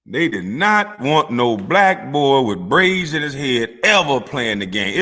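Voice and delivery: normal talking voice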